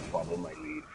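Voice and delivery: low, soft conspiratorial murmur; quiet secret cue; hushed aside. Retold or imitated. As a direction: drop the voice to tip off a friend. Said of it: "transform my character".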